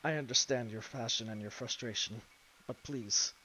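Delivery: calm her with grave, low resolve; solemn lion-robed warrior. Acted. Warrior calming the angry woman, low and grave.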